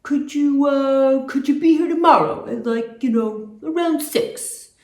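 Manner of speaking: loud, put-on producer voice; Richard Perry. Producer inviting the singers over, loud put-on man's voice. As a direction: imitate the man, big and hesitant. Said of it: "he kinda had a deep voice like this".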